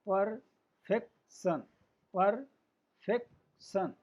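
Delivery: Perfection very slowly as पर … फेक … शन; teacher's slow syllable-by-syllable demo; in three parts. Shown as a demonstration. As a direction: break perfection into three separate beats. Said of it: "तीन part में उच्चारण करने".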